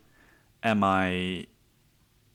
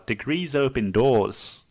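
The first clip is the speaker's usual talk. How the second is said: put-on slow, drawn-out Aussie accent; mock-sage Australian accent; 3 semitones higher than usual. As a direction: deliver the advice in put-on Australian. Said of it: "say it in a fucking Australian accent".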